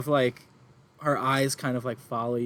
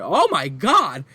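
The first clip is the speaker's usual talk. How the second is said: surprised; 4 semitones higher, 11 dB louder, slower than usual. Acted. Woman sees the bird.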